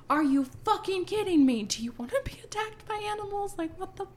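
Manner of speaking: high-pitched acted tirade, loud at first; exasperated outrage. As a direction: berate him in mock disbelief. Acted outburst at the congressman.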